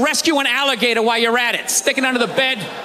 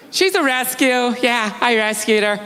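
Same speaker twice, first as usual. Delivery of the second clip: smug pet owner; 2 semitones lower than usual. Retold or imitated. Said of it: "I hate when people say that".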